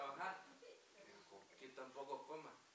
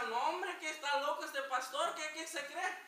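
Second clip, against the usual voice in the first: higher, louder griping in the congregation's voice; mock indignant grumble; 10 semitones higher, 7 dB louder than usual. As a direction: mimic churchgoers complaining the pastor is crazy. Gemeinde murrt über frühen Gottesdienst.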